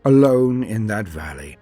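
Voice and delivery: usual speaking voice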